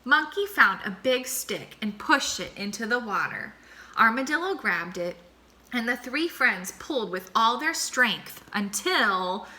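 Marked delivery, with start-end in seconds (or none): none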